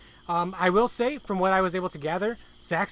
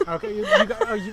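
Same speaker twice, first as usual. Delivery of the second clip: laughing; 14 semitones higher than usual. Real, not acted. Laughing at the Vine bit.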